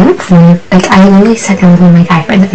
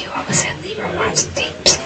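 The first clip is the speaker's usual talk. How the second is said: soft voiceless whisper, almost under the breath; very soft whispered reminder; 14 dB quieter than usual. Retold or imitated. Hushed quote continues.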